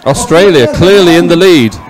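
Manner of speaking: rising thrill as the boats cross; excited race call. Real, not acted. Race finish, calling the leader.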